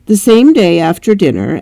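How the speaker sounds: ordinary delivery, nothing marked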